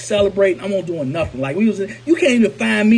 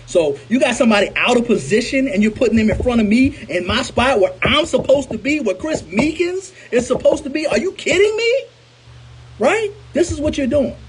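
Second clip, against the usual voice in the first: indignant disbelief; 5 semitones higher than usual. Retold or imitated. Benched player protesting that someone took his spot, indignant. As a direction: climb higher and higher into an incredulous outburst.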